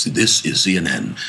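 lightly deepened James Earl Jones voice; a hint of the CNN announcer's deep voice; James Earl Jones impression. Imitating the famous CNN voice-over, lightly deepened. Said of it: "I was imitate".

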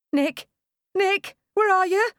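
high, loud desperate calls for Nick; woman in labour calling for her husband, high and frantic. A narrator's reading. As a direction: call out for him in panic, pitch climbing.

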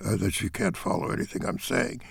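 soft, swallowed, barely voiced mumble; mumbling demo. Shown as a demonstration. Director demonstrating bad inaudible delivery, swallowed mumble. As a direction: mumble it so nobody can follow. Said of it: "It's not about talking like this".

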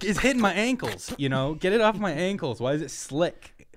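high-pitched mock griping; mock complaint. Player voicing a character griping about his ankles, mock complaint.

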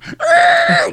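high, loud needle-scratch noise; record scratch. Acted. Comic stop after the exorcism question, mock record scratch. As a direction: imitate a needle skidding off a record. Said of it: "You hear the needle on the record".

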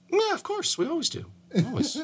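high, mocking of course; sarcastic high yes. Mocking friend Sean, sarcastic high agreement. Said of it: "And I was like".

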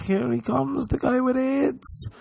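high catty gossip voice; high mocking whisper-ish gossip; gossipy voice. Imitating gossiping people.

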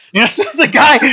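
real laugh at the punchline. Real, not acted. Pastor laughing at his own story's twist, laughing. Punchline, same church reveal.

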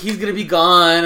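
a touch of a wail; mock lament. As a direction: imitate her upset protest. Voicing upset roommate.

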